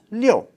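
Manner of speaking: "liu" falling steeply from high; fourth tone. Shown as a demonstration. Teacher showing learners number six, falling fourth tone. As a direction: start "liu" high and drop straight down. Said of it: "That's another fourth tone".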